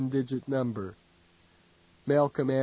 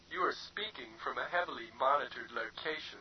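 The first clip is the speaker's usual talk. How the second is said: quiet, prim machine voice; a softer, prissy computer advising; a touch of prim synthetic politeness; 10 dB quieter, faster than usual. A narrator's reading. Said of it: "the computer advised primly".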